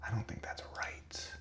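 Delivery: muttered under the breath; whispering. Real, not acted. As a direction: whisper it to yourself. Muttering doubt to himself while coding.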